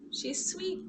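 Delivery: soft, fond whisper; whispered aside. Real, not acted. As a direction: murmur it tenderly about the cat. Fond aside about her cat.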